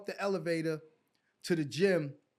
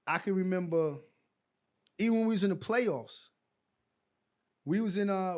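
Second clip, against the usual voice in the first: ordinary delivery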